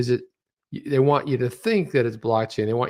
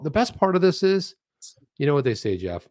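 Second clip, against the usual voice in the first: ordinary talk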